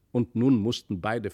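usual speaking voice

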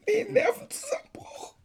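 high, tearful laugh breaking into a sob; choked laugh-cry; laughing into tears. Acted. Confessing partner's breakdown.

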